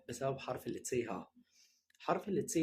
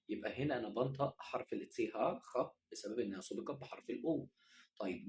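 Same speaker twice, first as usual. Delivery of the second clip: ordinary talk, nothing marked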